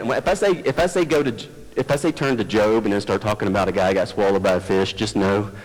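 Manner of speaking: ordinary delivery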